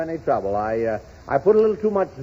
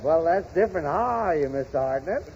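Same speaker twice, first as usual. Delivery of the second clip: normal talking voice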